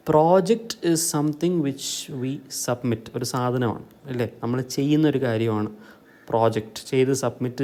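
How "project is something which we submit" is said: normal talking voice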